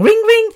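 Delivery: high-pitched mimicked phone ring; imitated phone ringing. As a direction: trill it like a ringing telephone. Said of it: "it rings all day long".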